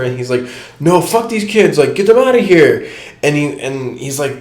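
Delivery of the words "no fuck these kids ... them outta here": high, loud mock rant with a laugh; mock furious. Drunk frat leader ranting at the homie about his pantry, mock furious. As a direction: ham up the raging frat guy.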